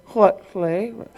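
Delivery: imitating the clerk's unintelligible speech; hammed-up incoherent clerk; mock garbled question. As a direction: mumble the question into mush. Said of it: "she one-upped me".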